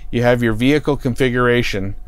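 ordinary delivery

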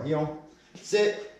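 high, sharp one-word commands; firm dog commands. Real, not acted. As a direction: bark short orders at a dog.

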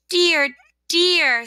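high, loud exclamation; exasperated rosebud. Acted. Rosebud fed up with sun and rain, exasperated.

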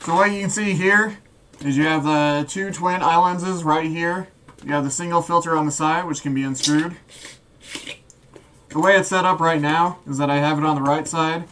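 muffled voice inside a gas mask; through a gas mask. Real, not acted. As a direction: talk with the mask sealed over your face. Demo talking while wearing mask.

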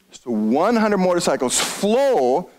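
slow, leaning into "flow" with a lift; stress on flow. Shown as a demonstration. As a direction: linger on "flow" and let it rise. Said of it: "I want to emphasize that word flow".

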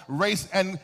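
ordinary talk, nothing marked

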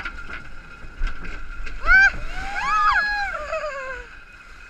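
high shrieking laugh; screaming laugh. Real, not acted. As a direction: shriek with laughter as water hits. Hit by the water splash.

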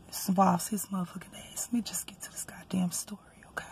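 hushed, unvoiced confiding whisper; conspiratorial whisper to viewers; whispered aside. Real, not acted. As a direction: lean in and whisper it. Whispered aside before the story.

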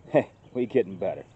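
ordinary talk, nothing marked

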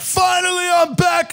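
shouted high, triumphant return; mock relief. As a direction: cry out like you're freed. People returning outside.

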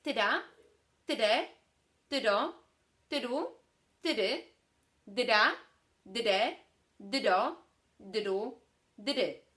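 Tyra, tyre, tyro said with the R as a ridge D, punched loud; speech therapist's syllable drill. Shown as a demonstration. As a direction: say each syllable crisp and loud for the learner. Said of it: "Brzmi to tak".

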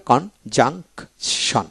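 slow কন-জাং-শন with clear gaps; very slow, split-up conjunction; syllable by syllable. Shown as a demonstration. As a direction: read conjunction in three separate syllables. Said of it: "এইভাবে".

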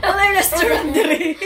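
loud high cackling laughter; laughing fit. Real, not acted. Mother bursts out laughing at the sentence. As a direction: laugh through the words.

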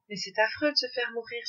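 usual speaking voice

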